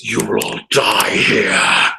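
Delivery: slow, drawn-out villain threat; menacing enemy king. Acted. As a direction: pronounce doom on the whole party, very slowly. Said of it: "he looks at the rest of you and says".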